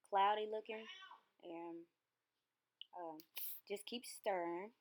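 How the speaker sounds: talking around candy; words round a piece of candy; mouth full. Real, not acted. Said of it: "I got candy in my mouth".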